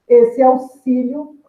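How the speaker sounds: "auxílio" said slowly, the final "io" in one glide; teacher slowly showing "auxílio" with the "io" as one syllable; slow demo. Shown as a demonstration. Teacher to students, slowly showing "auxílio" with the "io" as one syllable. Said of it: "eu falo ele num só impulso".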